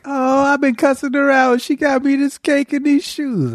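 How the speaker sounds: high, slow sheepish voice; sheepish after the surprise; mock Quisha. Quisha regretting the cussing, high and slow. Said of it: "Quisha was like".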